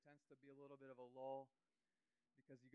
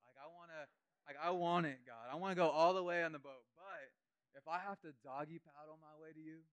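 louder bursts, pleading; a hint of an eager believer praying; 11 dB louder than usual. Preacher voicing a believer's bold prayer, lightly acted. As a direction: push it like a believer promising God. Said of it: "you start saying".

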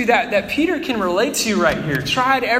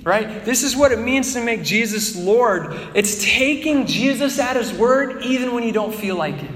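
ordinary talk, nothing marked

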